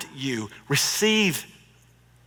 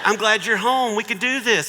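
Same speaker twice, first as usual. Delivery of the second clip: mock sweet greeting; 8 semitones higher, 4 dB louder than usual. Mock greeting to returning spouse.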